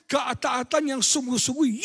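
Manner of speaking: normal talking voice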